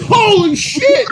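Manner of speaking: high, slow laughing yelp; mock shocked shout. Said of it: "diagnosed the person with".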